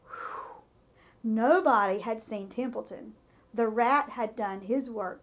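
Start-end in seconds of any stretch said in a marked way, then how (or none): 0.1-0.6 breathy whew of relief; relieved sigh. A narrator's reading. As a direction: let it all out in one sigh. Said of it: "He sighed with relief".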